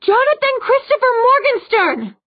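sustained high, loud scream; screamed full name. A narrator's reading. Clary summoning Sebastian by his full name, screaming. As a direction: scream the whole name out, far above speaking pitch. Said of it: "she screamed".

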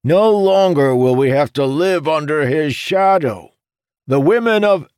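high, loud, grandiose speechifying; shouted proclamation. A narrator's reading. Jacob proclaiming freedom from Amandal's shadow, shouting. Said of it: "resumed shouting".

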